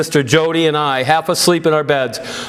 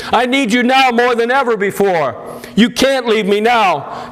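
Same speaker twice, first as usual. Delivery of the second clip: much higher, demanding and insistent; strong demanding plea; 6 semitones higher than usual. A narrator's reading. Daughter insisting to her dying father, demanding and much higher. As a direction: demand that he stay, holding firm. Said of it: "My voice shaky yet strong and demanding".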